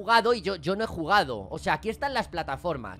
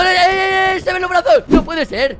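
loud high alarmed cries; frightened; 10 semitones higher, 12 dB louder than usual. Real, not acted. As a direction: shout in alarm. Monster arms appear.

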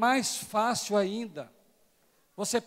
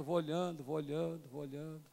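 each repeat quieter, trailing off to almost nothing; fading away; 4 semitones lower, 12 dB quieter than usual. Acted. Preacher scanning the pews, voice fading out. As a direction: let the words fade as the eyes move.

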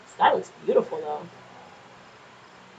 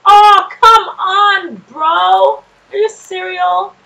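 exasperated shout; 8 semitones higher, 10 dB louder, much slower than usual. Real, not acted. Frustrated at the game.

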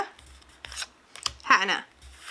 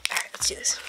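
whisper; 8 dB quieter than usual. Real, not acted. Psyching up before opening pack.

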